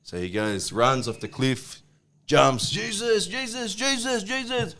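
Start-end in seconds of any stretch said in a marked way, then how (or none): 2.7-4.8 mock panicked plea. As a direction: call on your saviour while falling, hammed-up.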